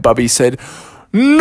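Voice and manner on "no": high, loud despairing cry; anguished cry. Acted. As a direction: wail at the loss. Bubby hears wagon is broken.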